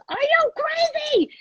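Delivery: very high, drawn-out squeal of disbelief; stretched high-pitched exclamation; mock outraged shriek. Imitates girlfriend diving after the card.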